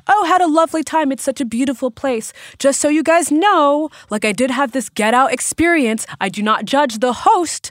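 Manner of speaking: overly sweet review; chirpy review voice. Retold or imitated. Quoting her Airbnb review, chirpy and sing-song.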